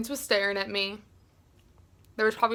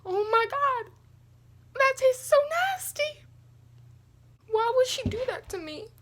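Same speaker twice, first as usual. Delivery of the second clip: very high-pitched wail of disgust; disgusted whine; 13 semitones higher than usual. Real, not acted. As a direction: whine like a betrayed victim. Reacting to a nasty taste.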